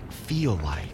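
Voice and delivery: hushed, reverent question; whispered curiosity; awed whisper. Acted. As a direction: drop into a whisper of wonder. Reporter asks in awe about relic.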